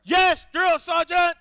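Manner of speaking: high, loud recruit reply; barked military recruit reply. Acted. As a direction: snap back at the sergeant. Recruit answering sergeant.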